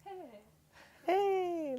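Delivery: "Hey" lifted up high through a grin; "Hey" tossed up high with a grin; grinning hey. Shown as a demonstration. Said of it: "where you're almost grinning".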